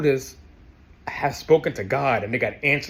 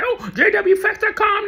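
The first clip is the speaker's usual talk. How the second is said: mocking, much higher; mock witness voice; 4 dB louder than usual. Ex-witness mimics witnesses spotting him in the hall, mocking. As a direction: squeak it out like a sneering witness.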